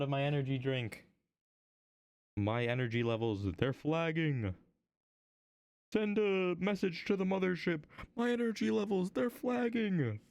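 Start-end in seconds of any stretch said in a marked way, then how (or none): 5.9-10.2 high, put-on spaceship crew voice; hammed-up plea to the mothership, stretched at the end; mock distress call.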